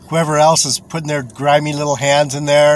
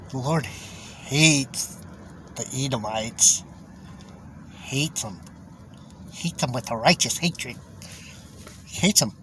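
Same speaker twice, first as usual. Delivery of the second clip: much softer, slow, grim; hushed condemnation; 3 semitones lower, 12 dB quieter than usual. Real, not acted. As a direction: drop to a low, intense hush. Preacher condemning the Edomites.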